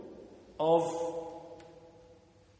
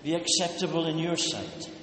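prayer; 5 semitones lower, 4 dB quieter than usual. Acted. Opening prayer before sermon.

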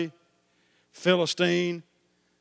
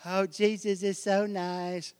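slow, syrupy mock of sugary piety; mock-sweet voice of shallow believers; mocking sweetness; 4 dB quieter, slower than usual. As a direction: mimic a saccharine churchgoer. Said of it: "you know, people".

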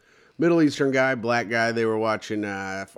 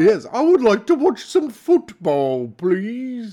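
hammed-up British accent, higher and louder; mock British football guy; 7 semitones higher, 4 dB louder, slower than usual. Friend doing a mock British football fan voice. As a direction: put on a posh British pub accent. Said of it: "that was Homer Simpson in".

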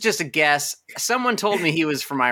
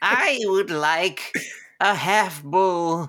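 put-on slow, grand old-fashioned son addressing his mother; old-timey son; 4 semitones higher, much slower than usual. Minotaur boy formally asking his mother, in a slow, grand voice. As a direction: make it stately and antique, drawing it out. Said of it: "since I was a little minotaur boy".

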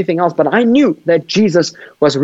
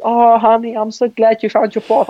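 high, sweet motherly voice; gentle mom; 6 semitones higher than usual. Retold or imitated. Mother gently praising her son for his new faith, high and sweet. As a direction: play the kind mother, pitched up. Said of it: "She said".

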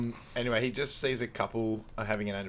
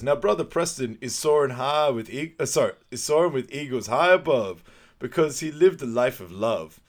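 loud, higher rhyming eulogy preacher; Al Sharpton; 4 semitones higher, 8 dB louder than usual. Retold or imitated. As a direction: preach it out at the funeral, hammed up. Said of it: "there's Al Sharpton".

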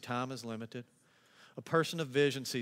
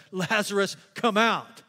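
loud call; 6 semitones higher, 9 dB louder, slower than usual. Retold or imitated. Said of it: "called out in a loud voice".

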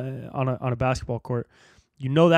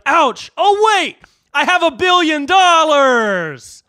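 high, loud, drawn-out holler; slow triumphant mock shout; mock realization; 11 semitones higher, 6 dB louder, much slower than usual. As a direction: cry out in pain, then slowly belt the boast. Acting out stubbed-toe billionaire.

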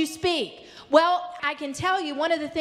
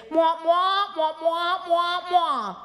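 nonsense wah-wah voice, a bit higher; airy gushing darling tone; 3 semitones higher, slower than usual. Retold or imitated. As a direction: mwah mwah like a muffled Peanuts teacher. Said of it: "what you hear is".

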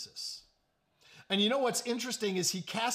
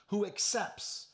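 ordinary delivery

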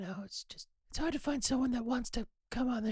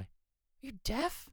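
soft, low-key muttered question; mumbled question; 3 semitones lower, 6 dB quieter, much slower than usual. Acted. Sound engineer questioning host's hearing, mumbled. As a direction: ask it too quietly to be heard. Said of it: "Speak up, Billy".

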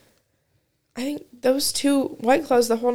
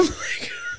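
soft laugh; 13 dB quieter than usual. Real, not acted. Laugh ending the story.

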